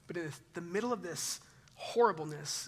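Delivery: usual speaking voice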